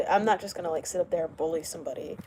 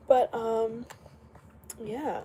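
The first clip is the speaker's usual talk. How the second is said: normal talking voice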